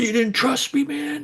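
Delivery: hammed-up high voice of an offended friend; mock hurt friend. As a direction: squeak it out like a wounded buddy. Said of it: "so you went".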